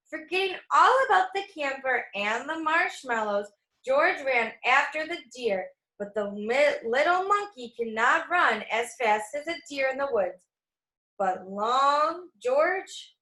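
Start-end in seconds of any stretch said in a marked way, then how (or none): none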